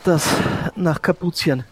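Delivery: normal talking voice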